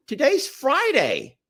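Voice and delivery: high, slow imitation of mom; mom's voice. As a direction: insist slowly as a confused mother. Voicing confused mother in story.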